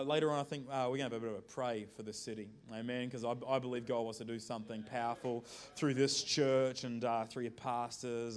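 ordinary talk

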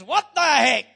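sudden loud exclamation; mock outburst. Preacher voicing Paul's defiant retort, loud. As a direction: blurt it out loud and high.

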